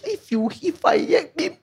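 high, laughing I-told-you-so; mocking inner voice. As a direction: tease herself with laughter. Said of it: "I heard".

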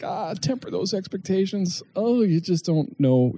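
high, held-back voice of doubt; cautioning inner voice. Acted. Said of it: "part of me that's like".

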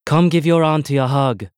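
lightly voiced gushing auntie; a hint of a doting auntie; eccentric aunt. A narrator's reading. Eccentric aunt greets nephew.

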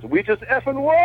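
high, thrilled shout-out of a text; excited race engineer, all capitals; all-caps excitement. Retold or imitated. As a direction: read the text like it's screaming. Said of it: "all capitals".

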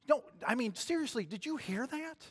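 put-on high, insistent young voice; indignant little brother. David urging his brothers to listen, high and insistent. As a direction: press them, pitched well up.

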